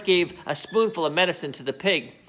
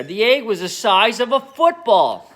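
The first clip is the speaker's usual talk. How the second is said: ordinary delivery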